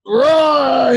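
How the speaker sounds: loud, high imitated roar; lion's roar. Lion roaring as something rears its head, imitated roar. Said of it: "I roar".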